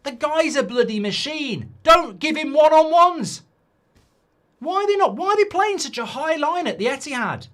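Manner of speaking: loud, high exasperated yelling; furious shout. Real, not acted. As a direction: bellow it in disbelief. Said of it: "I'm fucking fuming with that".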